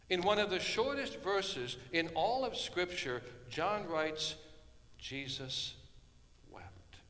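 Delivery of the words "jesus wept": hushed. Acted. Quoting Jesus wept, hushed.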